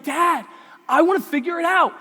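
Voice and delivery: high, loud acted complaint; protesting child. Child rebuffing dad over Legos, loud mock protest. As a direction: imitate a kid pushing back at a parent.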